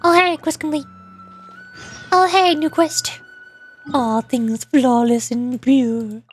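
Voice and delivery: ordinary talk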